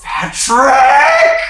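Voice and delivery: high, loud theatrical cry of a name; hammed-up cliffhanger shout; dramatic shout. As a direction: yell it out, rising at the end.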